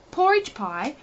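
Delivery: usual speaking voice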